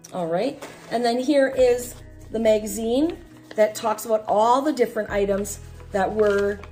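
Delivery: usual speaking voice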